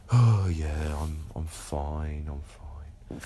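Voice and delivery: sighed "I'm fine", fading to an unvoiced breath; sighing, fading. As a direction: mope and sigh it out, trailing to nothing. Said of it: "look at their shoes and sigh and go".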